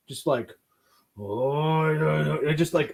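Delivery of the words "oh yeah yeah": imitated stoic grunting drawn-out voice; a hint of a stoic film swordsman; stoic samurai. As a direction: lightly do a stoic samurai hero. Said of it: "Ogami Ito just being stoic".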